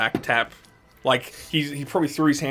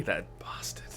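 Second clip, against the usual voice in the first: whispering; 4 semitones lower, 12 dB quieter, slower than usual. Acted. Shopkeeper mutters insult.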